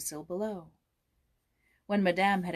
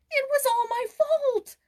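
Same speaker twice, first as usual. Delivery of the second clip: put-on high, slow, childlike and near crying; a boy about to burst into tears; tearful boy's confession, pitched high; close to tears; 8 dB louder, slower than usual. A narrator's reading. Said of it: "ready to cry".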